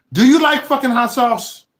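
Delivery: sudden high yell at John; shouted demand. Real, not acted. Yelling at John to answer about hot sauce. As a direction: bellow the question, losing patience.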